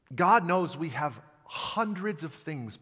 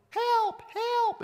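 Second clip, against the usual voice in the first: put-on high, panicked child crying for help; a scared young boy calling out from the river; little kid voice. Boy caught in the river yelling for help, little kid voice. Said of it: "in my little kid voice".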